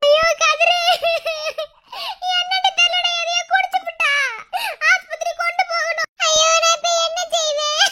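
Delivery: high-pitched crying lament; tearful panic. Acted. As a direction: sob out the bad news helplessly. Tearfully reports the poisoning. (அழுகையுடன் பதற்றமாக)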